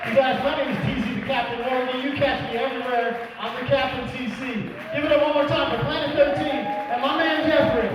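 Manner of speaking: loud, high hype-man call; emcee announcement. Acted. Comedian closing the show, announcing himself loudly. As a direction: pump up the room like a club MC.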